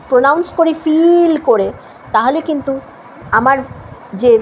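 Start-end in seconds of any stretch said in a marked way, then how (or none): 0.8-1.3 ফিল stretched to "feeel" with a long ee; long E mispronunciation. Shown as a demonstration. Teacher showing a mistake listeners won't understand, overlong ee. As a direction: draw out the ee in ফিল, wrongly for "fill". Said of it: "আমি যদি pronounce".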